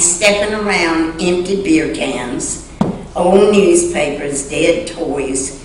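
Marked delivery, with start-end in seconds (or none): none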